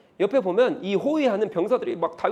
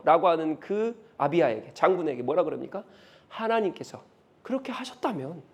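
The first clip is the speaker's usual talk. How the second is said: ordinary talk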